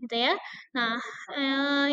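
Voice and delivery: ordinary talk, nothing marked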